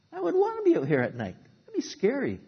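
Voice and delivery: imagine being out there at night, frightened; scared high voice. Retold or imitated. Preacher voicing his own fear on a stormy night, scared and high. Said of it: "And I thought".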